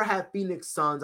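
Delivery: ordinary delivery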